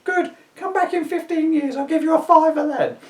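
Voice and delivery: put-on very high cheerful old woman; old lady voice. A narrator's reading. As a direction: chirp it like a sweet old granny.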